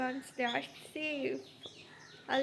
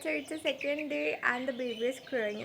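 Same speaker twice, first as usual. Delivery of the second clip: normal talking voice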